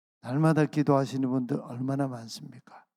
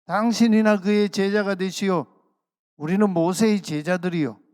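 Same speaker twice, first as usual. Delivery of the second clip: loud, higher-pitched angry retort; hurling abuse; 6 semitones higher, 8 dB louder than usual. Retold or imitated. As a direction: throw it at him like an insult. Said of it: "막 욕을 퍼부으면서 말하지요".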